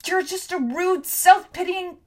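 high, loud furious tirade; angry tirade. A narrator's reading. Tom rants at Hester, high furious tirade. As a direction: let the anger out fully.

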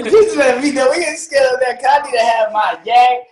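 ordinary talk, nothing marked

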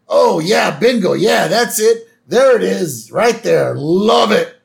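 loud, high, thrilled approval; excited yes-men all at once. Retold or imitated. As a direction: cheer like you just found it. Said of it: "all three of them are like".

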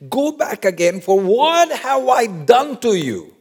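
high-pitched, incredulous protest; shocked. Acted. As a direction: push the question up high in mock shock. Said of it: "that's why he's shocked".

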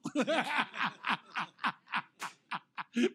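long, loud real laugh; real, rolling belly laugh; hearty laughter. Real, not acted. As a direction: crack up at your own story. Laughs at wife's line.

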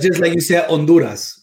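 Honduras said on-DOO-ras with a tapped r; Spanish Honduras. Shown as a demonstration. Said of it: "So say it again".

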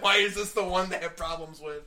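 loud mock-upset complaint; playful outraged wail; retold upset. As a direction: imitate an exasperated friend, loudly. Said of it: "she was really upset".

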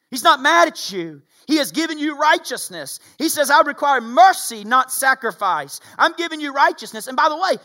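loud, high, fervent sermon delivery; rising revival preacher cry; preaching at full force. Acted. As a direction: preach it hard to the whole room. Preacher builds to a climax.

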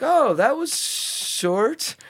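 high, very slow mock comment; hammed-up friend noting it was short; mock Melissa remark. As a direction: drawl the remark like a teasing friend.

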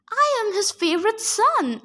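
booming. A narrator's reading. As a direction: boom it to the whole crowd. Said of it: "Antaeus boomed".

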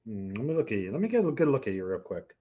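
normal talking voice

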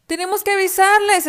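high, loud alarmed cry; urgent warning to the brothers; high loud piglet exclaiming in alarm. Acted. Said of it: "exclamó el cerdito".

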